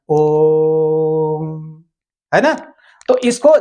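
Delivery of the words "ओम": ओम with the ओ held long; ओम, ओ को लंबा खींचकर; drawn-out Om. Shown as a demonstration. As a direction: stretch the ओ of ओम out slowly. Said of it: "ओ को हम लंबा खींचकर तब इसका उच्चारण करते हैं".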